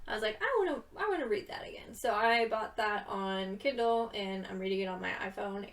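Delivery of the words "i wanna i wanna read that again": rushed, high-pitched eager self-quote; her past self. Retold or imitated. Quoting herself deciding to reread, high and rushed. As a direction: blurt it out quickly in a high, eager voice.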